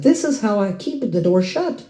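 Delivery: high, assured reply; confident comeback. Retold or imitated. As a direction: settle the argument with certainty. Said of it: "I said".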